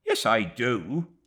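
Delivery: loud, slow angry retort; angry cry. A narrator's reading. Mrs Joram snapping back at David, angry. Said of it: "cried Missus Joram angrily".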